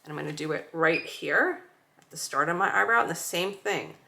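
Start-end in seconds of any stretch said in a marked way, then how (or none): none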